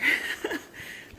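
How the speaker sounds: breathy high giggle. Real, not acted. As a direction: giggle at yourself. Giggling at own craft.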